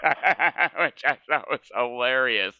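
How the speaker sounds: can't hold back the laugh; amused laughter breaking through the words. Real, not acted. Recalling a funny compliment.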